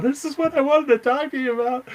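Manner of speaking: high, loud, gushing squeal; overexcited fan. Retold or imitated. Fan finally getting to ask his hero, overexcited squeal.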